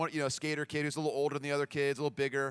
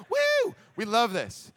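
high, loud, drawn-out whoop; retold cheering; 7 semitones higher, 6 dB louder, much slower than usual. Moms high-fiving each other, whooping with delight.

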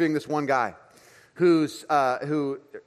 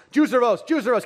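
higher, louder mock yell of a child; imitated yelling; 8 semitones higher, 5 dB louder than usual. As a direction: repeat it insistently, as if yelling for something. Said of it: "he keeps yelling".

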